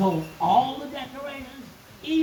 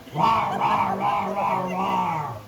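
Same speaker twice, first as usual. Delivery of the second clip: growling giant; 2 semitones lower, much slower than usual. Acted. Giant gobbling roadkill with growls. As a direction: growl like a beast eating.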